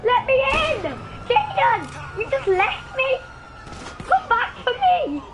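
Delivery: loud, high pleading shout, half laughing; desperate yelling. Real, not acted. Gamer yells at the teammate who abandoned him, loud and high. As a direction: yell after a teammate who ran off.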